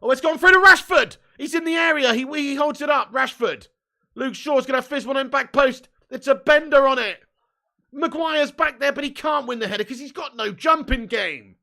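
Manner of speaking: loud, high rapid commentary; excited commentary. Real, not acted. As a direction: call the attack in rising excitement. Live play-by-play of attack.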